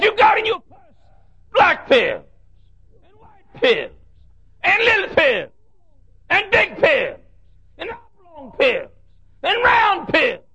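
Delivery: put-on old preacher preaching on pills to the church; shouting old preacher. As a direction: hammer each pill like a fired-up preacher. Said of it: "one old preacher got up".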